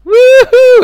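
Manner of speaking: loud, high-pitched woohoo; shrieked mock cheer; high whoop. Comic cheers Sunny Delight, high loud whoop.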